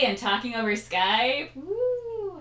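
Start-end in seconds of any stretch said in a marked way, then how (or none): 1.5-2.4 high, drawn-out "woo" cheer; soft excited woo. Real, not acted. Host welcoming a first-time guest, small high woo. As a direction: let out a light, high whoop.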